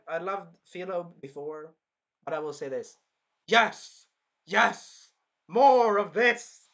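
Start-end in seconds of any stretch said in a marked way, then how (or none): 3.5-6.7 much louder, drawn-out triumphant shouts; excited cheering. Real, not acted. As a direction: cheer on the beating gleefully. Cheering villain's beating.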